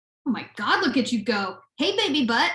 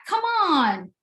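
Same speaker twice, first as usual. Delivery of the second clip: pleading shout; 6 semitones higher than usual. Real, not acted. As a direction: call after it, fed up. Pleading with pet to come.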